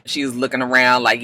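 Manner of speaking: ordinary talk, nothing marked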